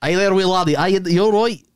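put-on Scottish greeting; mock Scottish; Scottish accent.

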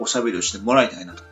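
normal talking voice

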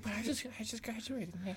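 high, soft mock whine; mock pleading. New graduate pleading with a chief, high mock whine.

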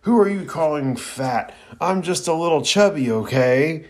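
hammed-up angry shout at the bully; furious roar. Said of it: "roars furiously".

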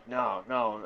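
weak attempt at a German accent. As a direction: start a clumsy German accent. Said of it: "I can't do a German accent".

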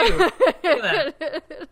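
slow, high, crying with laughter; laughing through tears. Real, not acted. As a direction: squeak it out while laughing.

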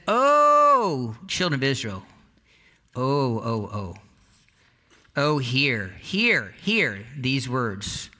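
solemn call. Acted. Moses calls to Israel, drawn out.

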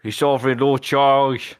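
attempted Cockney accent; a light, amateur Cockney accent. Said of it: "my very attempted Cockney accent".

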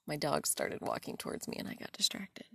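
soft, half-whispered aside to herself; distracted aside. Real, not acted. Talking to herself after her dog distracts her, soft and half-whispered. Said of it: "I lost my train of thought".

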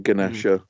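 "Ganesha" as guh-nasher, hard G before N; silent letter sounded. Shown as a demonstration. Co-host gives another example, "Ganesha" as guh-nasher. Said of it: "pronounce, like, silent letters".

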